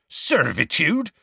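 slow, high scornful echo; scathing. A narrator's reading. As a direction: throw the word back with scorn. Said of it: "said Hagrid scathingly".

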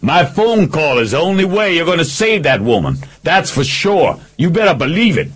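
high, insistent yelling; screamed threat. A narrator's reading. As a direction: scream your terms across the standoff. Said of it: "Picard screamed".

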